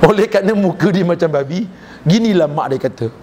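ordinary delivery

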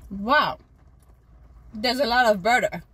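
talking with food in mouth; mid-bite tasting; chewing cookie. Real, not acted. Seen 3: Passenger bit cookie at -3s, holds piece while talking after.

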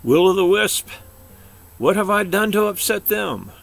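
high, loud worried question; desperate scared bear; panicked pleading; frightened plea. A narrator's reading.

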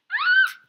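loud, very high shriek; startled scream. Real, not acted. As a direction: shriek as the monster appears. Startled during scary game.

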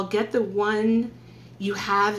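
normal talking voice

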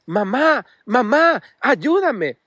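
voz asustada pidiendo ayuda; mock cry for help. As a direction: cry out for mom in panic. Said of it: "comienza a gritar".

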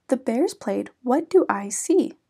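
ordinary delivery

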